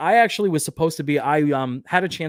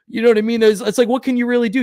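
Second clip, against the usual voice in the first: exasperated; 9 semitones higher, 8 dB louder, faster than usual. Real, not acted. Venting about stream failure.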